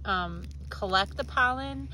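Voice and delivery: ordinary talk, nothing marked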